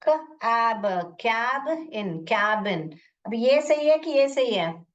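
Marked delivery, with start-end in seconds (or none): 0.1-3.0 क, एब, कैब इन, then blended कैबिन; sounding out. Shown as a demonstration. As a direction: build कैबिन piece by piece with short "a". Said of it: "दूसरा try कर रही हूँ".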